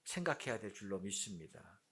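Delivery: ordinary talk, nothing marked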